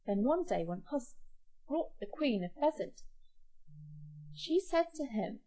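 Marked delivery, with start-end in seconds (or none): none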